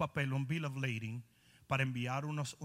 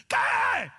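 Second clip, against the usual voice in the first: high, loud irritated what; a man yelling what through the window; annoyed shout; 10 dB louder than usual. Retold or imitated. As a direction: snap back at the stranger. Young man annoyed reply retold.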